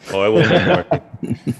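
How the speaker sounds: laughing. Real, not acted. Joking about mixing a drink.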